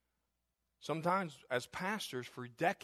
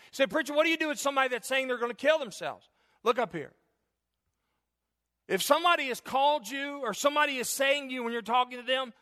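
higher, louder preacher's cry; full-force preaching; 7 semitones higher, 5 dB louder than usual. Acted. Pastor urging congregation to get help, preaching loud. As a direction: drive it home from the pulpit.

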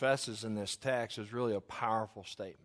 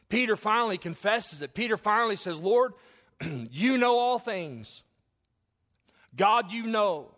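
loud preached confession; 10 semitones higher, 12 dB louder than usual. Acted. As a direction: pour Peter's confession out with full pulpit force. Peter's confession preached at full force.

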